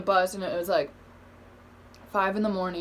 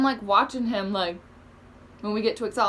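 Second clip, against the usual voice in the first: ordinary talk, nothing marked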